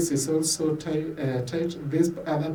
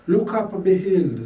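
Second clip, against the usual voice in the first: higher, louder, with weight on look and be; solemn call; 4 semitones higher, 6 dB louder than usual. Acted. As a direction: lift it as an appeal. Refrain inside the prayer poem.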